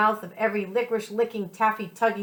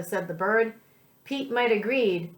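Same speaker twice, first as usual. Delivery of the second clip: ordinary delivery, nothing marked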